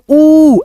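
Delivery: ऊ stretched long and falling; drawn-out long oo. Shown as a demonstration. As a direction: hold the ऊ sound long, teacher demo. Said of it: "इसको थोड़ा सा खींचेंगे". (ऊ को लंबा खींचकर बोलें)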